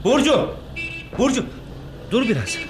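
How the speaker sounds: high, urgent call after someone. Acted. Oktay calls Burcu to wait, calling out.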